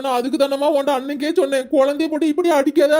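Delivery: normal talking voice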